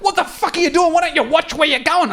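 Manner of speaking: mock angry woman. Retelling the woman driver shouting at him in traffic, high mock anger. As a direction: imitate the furious Merc driver yelling from her window. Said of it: "She's like".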